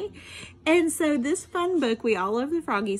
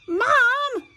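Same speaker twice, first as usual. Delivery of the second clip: put-on a high, louder childish yell for mom; 12 semitones higher, 5 dB louder than usual. Froggy calling to his mother from the kitchen, high yell. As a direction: call out like a hungry kid. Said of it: "yelled Froggy".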